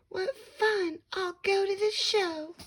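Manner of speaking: high, gleeful lazy-bird voice; sing-song Maisie. A narrator's reading. Narrator voicing Maisie as she spots the show, high and gleeful. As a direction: trill it out like a carefree bird. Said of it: "she sang out".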